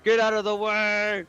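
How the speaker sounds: high, slow shout at the dwarf; character shout. Acted. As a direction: Mako barking at the dwarf in a put-on voice. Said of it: "So Mako tells Dwarf".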